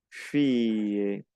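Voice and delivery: fille stretched as "fee-ye"; elongated double L. Shown as a demonstration. Teacher models double L as I-E, stretched "fille". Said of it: "es un poco más alargada".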